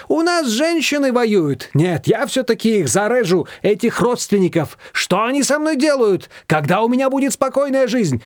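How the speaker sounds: high-pitched, wound-up complaint; exasperated rant. A narrator's reading. Shushana complaining about her relatives, exasperated. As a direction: rail against them in a high voice.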